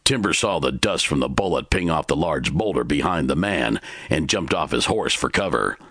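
ordinary delivery

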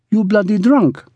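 high-pitched sulky accusation; petulant, high woman's voice; sulking. A narrator's reading. Ruby blaming Brigg for drinking, sulking. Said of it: "she sulked".

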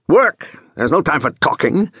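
bossy foreman's call; barked order. Acted. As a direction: cut off the chat and order him to work. Seryoshka orders his helper about.

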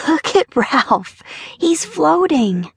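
put-on high, slow, drawn-out child's delight; childish wonder. A narrator's reading. Boy showing his sister the fish, childish wonder. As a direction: marvel like a little boy.

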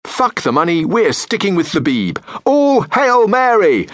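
usual speaking voice

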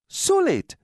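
high, sharp scolding; snapped. A narrator's reading. Mother scolding her daughter for waking late, snapping. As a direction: snap it out, pitched right up. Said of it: "she snapped".

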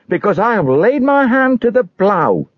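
high-pitched, fervent refusal; high voice, firm in conviction. Acted. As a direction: insist in a high, impassioned voice.

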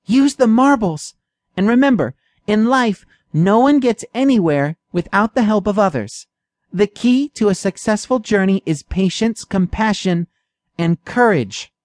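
high mouse voice giving calm advice; a small mouse tells the cat how to find his way; wise little mouse. A narrator's reading. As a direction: pass on the lesson gently as the small mouse. Mouse gives wise parting advice.

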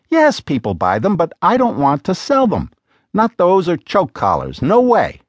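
a hint of an exasperated wife pressing her husband; Lena's voice. A narrator's reading.

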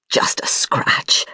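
strained, unvoiced whisper, slowly; slow, pained whisper, playing it down; through gritted teeth. A narrator's reading. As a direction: wave off the wound through the pain. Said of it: "his teeth gritted".

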